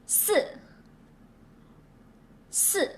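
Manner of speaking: 四 with a falling fourth tone. Shown as a demonstration. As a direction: drop the pitch on 四. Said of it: "let's say".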